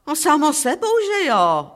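high-pitched, lightly acted old man; lightly voiced matter-of-course reply; character's reply. A narrator's reading. Petr answers driver in dialogue.

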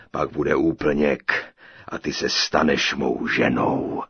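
low, slow, loud giant voice; booming giant. A narrator's reading. Giant greeting his captive bride, low slow booming. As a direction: thunder it out like a huge giant.

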